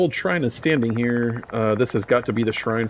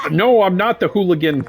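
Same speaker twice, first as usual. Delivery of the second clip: high shouted cry; 8 semitones higher, 5 dB louder than usual. Acted. Possessed student attacks, voiced line.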